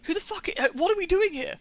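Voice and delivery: retelling his lost confusion in a high, strained voice; incredulous high voice. Retold or imitated. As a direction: squeak out total bafflement. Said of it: "I'm just like".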